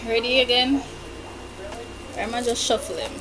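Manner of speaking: ordinary talk, nothing marked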